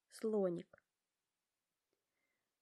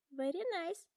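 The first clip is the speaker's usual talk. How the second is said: high, smiling giraffe voice; 9 semitones higher than usual. A narrator's reading. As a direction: say it with a smile, higher up. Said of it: "улыбается Жирафа".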